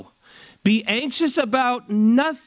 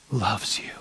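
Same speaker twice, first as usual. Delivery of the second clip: soft whisper of reassurance; 11 semitones lower, 5 dB quieter than usual. Real, not acted. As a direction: whisper it close and tender. Tender aside to congregation.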